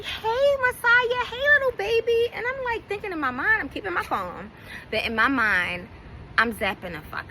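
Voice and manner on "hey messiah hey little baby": put-on much higher, sugary baby-talk voice; mocking her sweet greeting to the child; imitating Treese cooing. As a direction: coo at the little boy, pitched way up. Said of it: "She's like".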